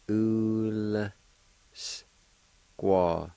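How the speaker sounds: "Ul-s. squatona" broken into long, separate sounds; syllable-by-syllable pronunciation. Shown as a demonstration. Teacher showing the L sound with the U, syllable by syllable. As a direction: hold the "Ul", then step through "squatona". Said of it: "pronounce the L sound with the U like this".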